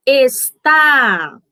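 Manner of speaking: Está with a long, loud TÁ; teacher's demo, es-TÁÁ; stress on last syllable. Shown as a demonstration. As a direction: punch and hold the TÁ of Está. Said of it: "com mais força do que as outras".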